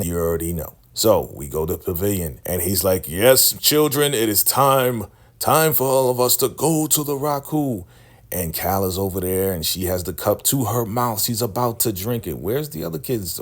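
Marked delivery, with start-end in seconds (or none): 3.1-7.8 preaching cult leader. Retold or imitated. Cult leader commanding the wounded woman to rise, booming preacher. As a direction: call down power like a faith healer.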